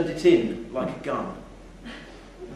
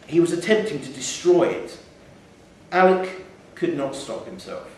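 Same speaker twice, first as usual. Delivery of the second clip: ordinary delivery, nothing marked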